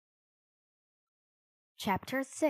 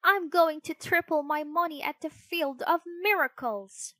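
high, raised puppet voice; defiant cry; 7 semitones higher, 6 dB louder than usual. A narrator's reading. Pinocchio defying the cricket, crying out high and loud. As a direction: insist loudly, pitched well up. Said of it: "Pinocchio cried".

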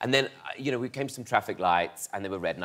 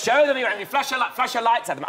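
excited dad; 10 semitones higher, 3 dB louder than usual. Retold or imitated. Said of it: "Dad goes".

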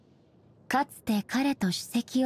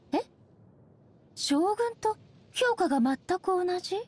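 higher-pitched, surprised question; taken aback; 8 semitones higher than usual. Acted. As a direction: react in disbelief at the identical verdict. Told her verdict matches Shogun's.

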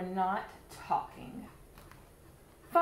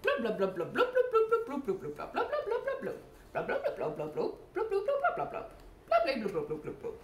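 talking bubble; 9 semitones higher, faster than usual. Acted. Fish trying to talk to kids in bubble language. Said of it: "I'll try talking bubble".

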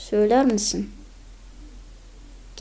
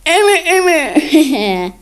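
mocking voice; 5 semitones higher, 6 dB louder, much slower than usual. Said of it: "Dalga geçiyorum biraz".

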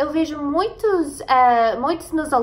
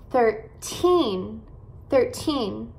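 put-on THIRTEEN stressed on a drawn-out TEEN; teacher's model of THIRTEEN, second syllable emphasized; stress on final syllable; much slower than usual. Shown as a demonstration. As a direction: hit the TEEN at the end of THIRTEEN. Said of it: "você vai enfatizar as sílabas, eh, no final".

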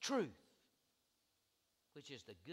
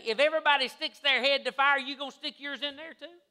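high, loud motherly comeback; scolding mother; 12 semitones higher, 14 dB louder than usual. Retold or imitated. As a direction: voice a mom challenging her child, starting loud. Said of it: "And she'd say".

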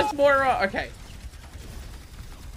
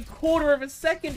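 ordinary delivery

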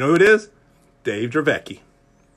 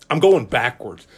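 ordinary delivery

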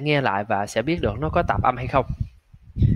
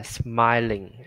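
slow demo. Shown as a demonstration. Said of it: "âm I với âm Y".

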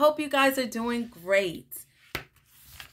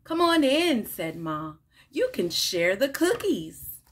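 animated picture-book read-aloud with lively character lines; reading to children. Acted. Teacher reading a picture book to children, bright and animated. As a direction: read it like story time for little kids.

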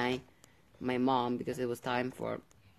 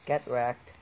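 ordinary talk

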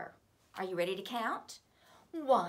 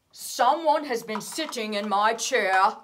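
Papa Bear voice; 13 dB louder than usual. A narrator's reading.

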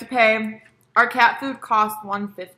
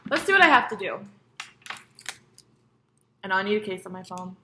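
normal talking voice

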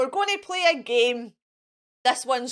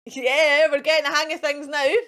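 usual speaking voice

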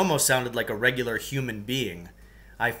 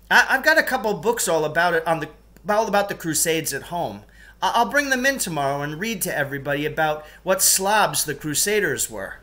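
eager rant; 6 semitones higher, 3 dB louder than usual. Acted. Pupil keeps ranting at teacher.

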